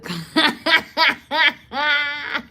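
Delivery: long loud high laugh. Real, not acted. Long laugh after the joke.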